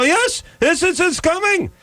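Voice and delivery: very high-pitched mock Israeli woman; Israeli neighbor accent. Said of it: "sixty-year-old Israeli".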